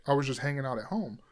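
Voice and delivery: ordinary delivery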